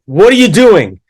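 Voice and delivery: loud, high, swooping boom; big booming voice. Retold or imitated. Teacher confronting a student at his desk, big booming voice. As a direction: imitate a teacher booming a scolding question. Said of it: "He has this big booming voice".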